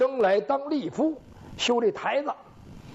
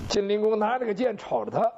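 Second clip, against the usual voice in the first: plain reading voice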